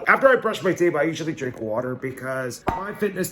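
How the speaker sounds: loud, high cheerful narration; host it with energy; upbeat vlog host. Acted. Upbeat morning vlog narration over music.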